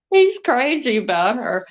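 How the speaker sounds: ordinary talk, nothing marked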